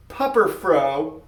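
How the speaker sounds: high, slow upset garble; garbled upset blurt. Acted. An upset boy answering his classmates with garbled words. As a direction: let the nonsense tumble out. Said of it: "whenever Robbie felt nervous".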